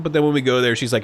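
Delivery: ordinary talk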